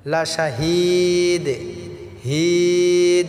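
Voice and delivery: লাশাহিদ chanted slowly, হিদ stretched; drawn-out recitation. Shown as a demonstration. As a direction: sing লাশাহিদ with a long held ending. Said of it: "এক আলিফ টেনে পড়ব". (লাশাহিদ টেনে সুরে পড়া)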